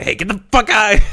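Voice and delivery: high, loud retold yell. Dad yelling at a drunk guy behind us, mock shout. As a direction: shout it like an angry dad throwing someone out. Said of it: "Just started yelling at him".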